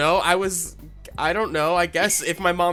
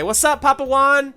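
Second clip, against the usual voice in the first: excited greeting; 9 semitones higher, 7 dB louder than usual. Acted. Greeting pizzeria owner loudly.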